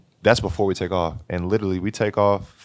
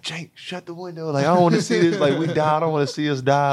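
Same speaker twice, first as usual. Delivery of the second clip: high, frantic begging to shut the window; a teammate's high-pitched terror, retold; panicked plea; 9 semitones higher than usual. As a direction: squeal it out like you're sure the plane is going down. Panicked teammate retold during engine failure.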